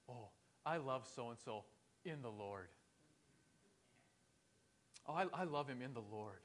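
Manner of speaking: mock sweet, soft and low pious phrase; sappy church talk. Christians masking dislike with pious talk, sappy. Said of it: "we add the little sappy "in the Lord".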